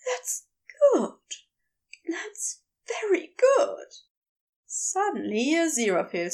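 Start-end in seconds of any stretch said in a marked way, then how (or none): 0.1-3.9 breathless, whispery gasps with pauses; gasping. A narrator's reading. Aziraphale praising Crowley in bed, gasping. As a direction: gasp it out haltingly, mostly breath. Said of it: "he gasped".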